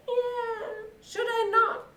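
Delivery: high, whining meow of a cat; unhappy cat. Acted. Cat answers the donkey and dog, high and unhappy. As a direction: answer as a miserable mewing cat. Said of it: "the cat was meowing and it was looked".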